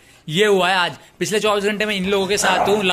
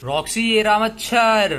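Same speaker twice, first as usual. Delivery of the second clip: slow, higher call with stretched words; drawn-out call; 4 semitones higher, 3 dB louder, slower than usual. Acted. Man showing Roxy the mosquito, slow drawn-out call.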